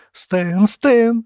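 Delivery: Shira's rising screams of Stan's name; panicked calling. A narrator's reading. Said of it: "запинаясь, пробормотала Шира".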